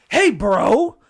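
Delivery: loud mock challenge, rising at the end; confrontational. Gym guy grabbing him by the shirt, loud mock confrontation. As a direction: imitate a gym bro calling someone out.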